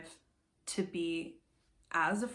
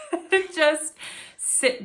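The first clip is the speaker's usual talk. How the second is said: laughing; 13 dB louder, slower than usual. Real, not acted. Laughs recalling fond memory.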